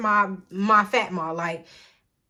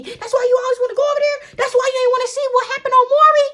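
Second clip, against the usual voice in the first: high-pitched scolding mom, fast; shrill-free mock mom, pushed up high; mom's high angry voice; 8 dB louder, faster than usual. Storyteller voicing mom arguing with partner, high and fast. Mom yelling in retold argument.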